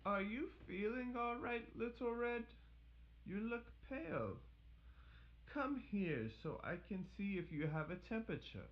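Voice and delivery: put-on high and soft, worried old lady; gentle concerned granny. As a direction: soothe her quietly. Grandmother voiced softly.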